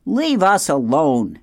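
frightened weasel squeaking at the robot. Acted. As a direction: squeak it out in fear. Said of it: "squeaked Dart".

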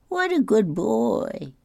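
high slow doting praise. Acted. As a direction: coo What a good boy! up high and slow.

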